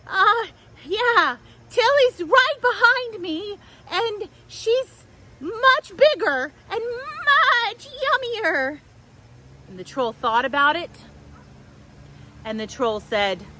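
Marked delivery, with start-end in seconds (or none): 0.1-8.8 high, pleading little goat voice; high squeaky little goat. Acted. Small goat begging the troll to let her pass, high and pleading.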